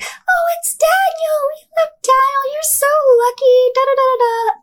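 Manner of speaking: sing-song mimicry of an adoring mother; imitating mom. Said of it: "my mom would be like".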